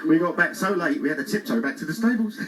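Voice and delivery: ordinary talk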